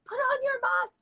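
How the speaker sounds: very high mock yell, no real volume; imitated shouting. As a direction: mimic nurses calling out an urgent order. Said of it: "they all were shouting at me".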